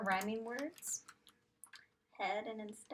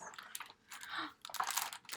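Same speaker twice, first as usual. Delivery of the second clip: gasp; 6 dB quieter than usual. Acted. Gasp before revealing answer.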